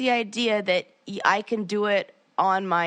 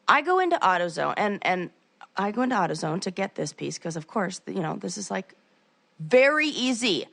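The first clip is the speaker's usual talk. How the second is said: usual speaking voice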